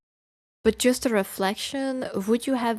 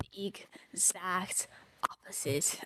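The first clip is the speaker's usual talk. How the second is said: much softer whisper; 11 dB quieter than usual. Real, not acted. Streamer whispering the rebuke. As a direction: drop to a whisper. Rant at the writer.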